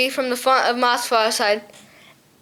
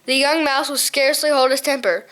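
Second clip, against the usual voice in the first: ordinary delivery, nothing marked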